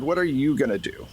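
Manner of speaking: ordinary talk, nothing marked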